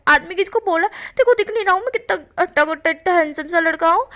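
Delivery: huffy, playfully insulted at being called a man; offended protest. Acted. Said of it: "Bhushan तब कहता है". (नाराज़गी भरा ऊँचा दावा)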